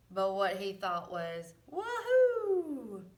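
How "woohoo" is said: whooping woohoo. A narrator's reading. Duck thrilled driving tractor.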